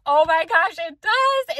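high delighted gasp; thrilled, rising exclamation; excited. Retold or imitated. Quoting her delighted discovery.

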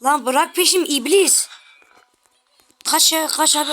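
slow frightened shout; panicked yell. Real, not acted. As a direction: yell at the chasing demon. Fleeing the demon in game.

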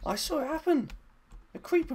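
sharp gasp, then high excited shout; shocked gasp. Real, not acted. Plant acting by itself.

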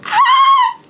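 high, loud squeal of joy; excited scream. Real, not acted. Fan screaming with excitement on concert day. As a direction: let out a thrilled shriek.

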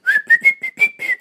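very high, loud crazy whistle. Retold or imitated. Imitating his crazy whistle at the store. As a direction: whistle like a madman. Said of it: "just really crazy whistle".

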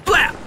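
high, short mouth-made shot; gunshot imitation. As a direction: mimic one rifle shot.

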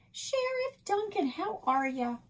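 put-on high, bright-pitched friendly hello from an older woman; Nan's greeting. A narrator's reading. Nan greets the sheriff at the door in a high, sing-song hello.

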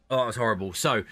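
ordinary delivery

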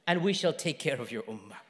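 soft, low, settling promise; quiet pledge closing the message; tender reassurance. Retold or imitated. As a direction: drop into a gentle, comforting hush. Retelling God's comforting promise.

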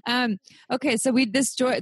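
normal talking voice

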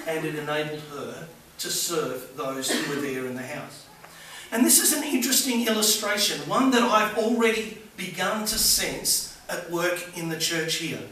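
ordinary talk

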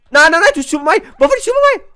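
high, loud panicked protest. Real, not acted. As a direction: squeal in alarm as they turn on you. Attacked by angry wolves. (høj panisk råben)

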